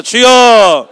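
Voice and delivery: 주여 shouted at full voice; shouted cry. Shown as a demonstration. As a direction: cry 주여 out loud with hand on heart. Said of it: "가슴에 손대고 따라해서". (가슴에 손 얹고 외치는 주여)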